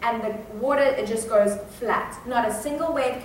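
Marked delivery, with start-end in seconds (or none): none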